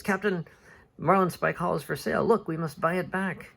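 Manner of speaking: high-pitched, urgent professor; excited news. Acted. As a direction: burst in with a discovery, acted. Calculus excitedly brings stale news.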